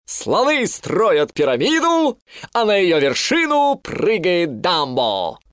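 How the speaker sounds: slow, grand and thrilled; excited. A narrator's reading. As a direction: savour the big plan slowly. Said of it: "— закричал он". (воодушевлённо, с размахом)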